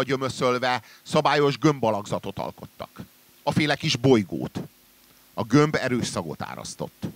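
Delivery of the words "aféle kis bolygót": ordinary delivery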